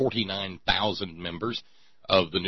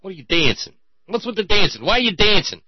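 high, loud mock angry cop; mock cop interrogation; 11 semitones higher, 5 dB louder than usual. Cops confronting a dancer, high loud mock interrogation. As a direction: grill the dancer like a hostile cop. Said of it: "they'll come up to you and go".

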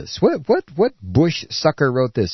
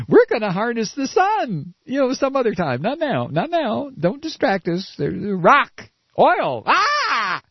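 much higher lightly voiced mock exec; mocking oil executives; 8 semitones higher than usual. Lightly voiced oil company mocked for greed. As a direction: brush off the sun, greedy for oil.

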